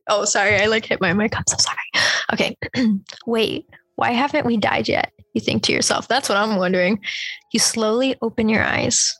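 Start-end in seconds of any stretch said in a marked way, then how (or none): none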